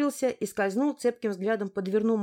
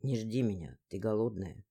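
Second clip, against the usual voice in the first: lower, softer, guilty male voice; even more apologetic, gently; guilty man's voice; 10 semitones lower, 6 dB quieter than usual. A narrator's reading. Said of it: "ещё более виноватая". (виноватый мужской голос)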